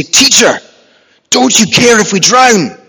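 loud, high-pitched desperate plea; desperate cry. Acted. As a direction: cry out to wake the teacher in desperation. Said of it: "And in desperation".

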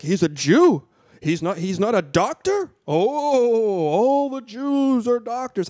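imitating the brother, drawn out and rising on the last line; mock bigoted brothers. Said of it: "And he's like".

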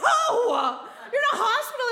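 high, loud laughing protest; shocked, laughing shout of no; retold shocked shout. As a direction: yell it out through laughter at the absurd idea. Telling brother off in hospital.

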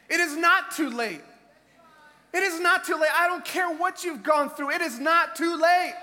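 loud, driving preacher's shout; rousing sermon climax; full-force preaching. Acted. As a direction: hammer it home to the congregation.

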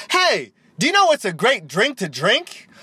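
high, swooping cheesy commercial voice; mock ad pitch. Host opens a fake Red Bull commercial, hammed-up ad voice. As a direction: pitch the question like a hammed-up TV spot.

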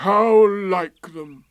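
slow, loud, high mournful donkey; bitter, drawn-out lament; Eeyore. A narrator's reading. Eeyore blaming the takers, gloomy. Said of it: "said Eeyore gloomily".

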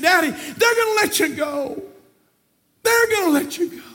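high, loud, preached storytelling; shouted story preaching. Acted. Preacher telling of Bunyan's daughter at the jail, shouted preaching. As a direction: cry the story out to the church.

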